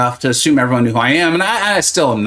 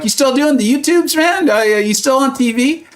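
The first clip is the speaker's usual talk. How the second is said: high mock ribbing voice of family; playful mocking uncle; teasing relatives; 10 semitones higher than usual. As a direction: imitate your family razzing you, voice pitched way up. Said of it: "They're like".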